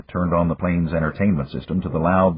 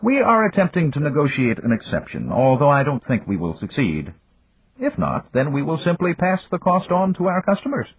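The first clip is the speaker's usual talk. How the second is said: put-on higher, measured voice of an old friend; Japanese magnate; 9 semitones higher than usual. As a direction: voice the older businessman, courteous and calm. Yamagata speaks.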